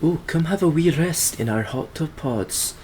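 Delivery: high, sing-song mock Scottish invitation; Scottish dialect. Mimicking a Scottish hot tub sign, high Scottish voice. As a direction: coax like a friendly Scottish sign. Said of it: "in their endearing Scottish dialect".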